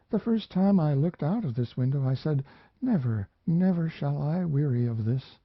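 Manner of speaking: put-on a lonely young woman remembering, a little lifted; young woman's voice. Mariana speaks to the narrator.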